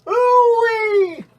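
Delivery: very high squeaky catchphrase; Mister Poopy Butthole impression. Reading the calendar, squeaks the character's catchphrase in a high voice. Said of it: "Mister Poopy Butthole".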